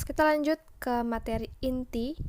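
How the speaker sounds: ordinary talk, nothing marked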